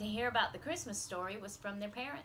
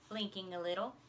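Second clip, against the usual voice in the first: usual speaking voice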